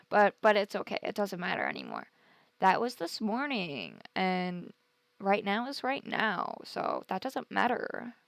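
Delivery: ordinary talk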